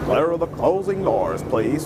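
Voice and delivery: slow muffled conductor call; mumbled subway intercom. Shown as a demonstration. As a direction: mimic a garbled train speaker. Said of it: "Did I get the tone right".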